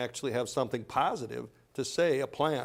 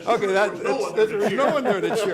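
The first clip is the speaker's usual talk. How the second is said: higher, louder, laughing while talking; laughing hard through the words; 9 semitones higher, 7 dB louder than usual. Real, not acted.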